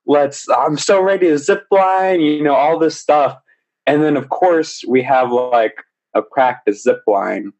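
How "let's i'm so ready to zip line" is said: high-pitched, pumped-up mock excitement; hyped-up impression, pitched high; imitating hyped guest. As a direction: act out someone raring to go. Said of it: "she was just so hyped about everything".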